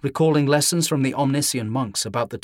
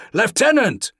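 loud call across a hall; shouting; 10 semitones higher, 6 dB louder than usual. A narrator's reading. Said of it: "shouted Pavel from across the hall".

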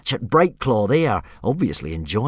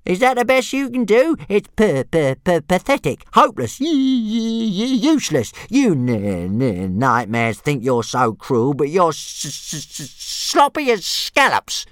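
much higher and louder stammering insults; stuttering dragon taunts; 8 semitones higher, 3 dB louder than usual. Acted. Toothless taunting the big dragon, high stuttering jeers. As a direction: spit out each insult through a stammer.

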